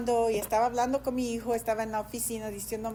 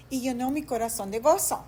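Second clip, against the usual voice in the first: normal talking voice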